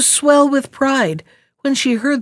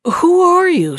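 high, loud frightened cry; startled piglet asking who is there; great fright; 9 semitones higher, 4 dB louder than usual. A narrator's reading. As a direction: jump up scared and call out. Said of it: "starting up in great fright".

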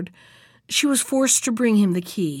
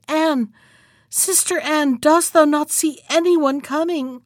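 higher-pitched anxious call; crying out; 6 semitones higher than usual. A narrator's reading. Wife to her sister on the tower, crying out. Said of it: "And then she cried".

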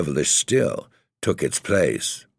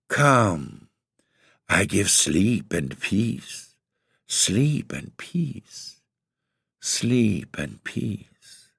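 high, very slow and softer, like a lullaby; soft soothing spell; 3 semitones higher, much slower than usual. A narrator's reading. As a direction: cast a sleepy spell with each word. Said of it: "soothing voice".

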